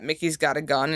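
usual speaking voice

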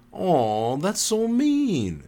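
high, drawn-out whine; mock-hurt whine. A narrator's reading. Teaser acting hurt after an insult, high whine. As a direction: whine it slowly, acting wounded. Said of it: "she whined".

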